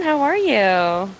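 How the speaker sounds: ordinary talk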